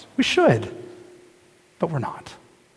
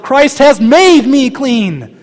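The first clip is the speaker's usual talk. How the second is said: insistent; 8 semitones higher, 9 dB louder, much slower than usual. Retold or imitated. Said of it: "you tell yourself".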